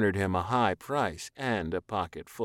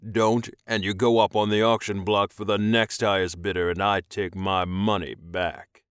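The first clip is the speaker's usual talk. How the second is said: loud, firm ultimatum voice; commanding alien master, threatening; 6 dB louder than usual. A narrator's reading. Alien buyer warning captive, stern threat. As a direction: deliver the threat with authority.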